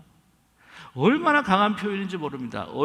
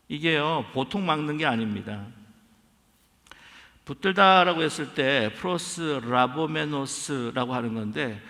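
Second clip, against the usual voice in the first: usual speaking voice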